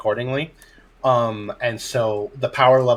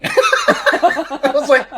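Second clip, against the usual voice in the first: laughing; 8 dB louder, much slower than usual. Real, not acted. Teller cracks up mid-story.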